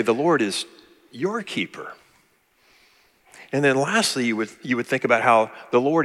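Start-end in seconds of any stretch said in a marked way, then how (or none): 0.0-1.9 your lifted and stressed, softly. Shown as a demonstration. Preacher showing a new emphasis: stress on your. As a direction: put the weight on the word your. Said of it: "And then next you would say".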